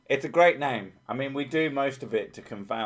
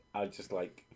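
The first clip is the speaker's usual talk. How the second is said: ordinary talk, nothing marked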